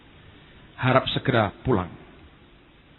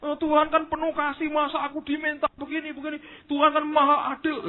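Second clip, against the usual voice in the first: much higher whiny protest; mock arguer; 14 semitones higher than usual. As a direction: whine the excuses up high, mocking. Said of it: "Berargumentasi terus".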